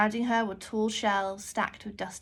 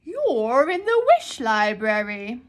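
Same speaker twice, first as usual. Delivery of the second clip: slow, loud, booming voice from above; booming librarian; 2 semitones higher, 10 dB louder, slower than usual. Acted. Librarian booms a welcome at the startled girl. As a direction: boom it out like a grand keeper of wishes. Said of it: "a deep voice boomed".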